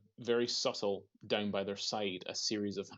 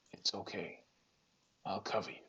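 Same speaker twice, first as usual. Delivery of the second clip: gravelly Welsh voice; 7 dB quieter than usual. Acted. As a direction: say it in a rough fantasy Welsh accent. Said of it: "Gravelly Welsh voice".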